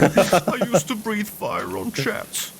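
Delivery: put-on high, drawn-out elderly voice; old dragon reminiscing. Aged streamer boasting to a nurse, high slow old voice. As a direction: stretch each word out, high and aged. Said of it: "Sushi being old and, like, talking to a nurse".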